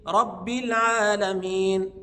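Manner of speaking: slow "رب" with held b, then i; doubled ba. Shown as a demonstration. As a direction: lean on the doubled b of "رب", drawn out. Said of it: "الباء مشددة ومكسورة". (نطق باء "رب" مشددة مكسورة)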